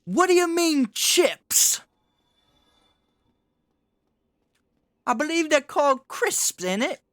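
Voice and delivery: mock English accent yelled high and loud; screaming British accent. Parodying viral accent videos for chat, a screamed mock British accent. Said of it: "they just scream something like that".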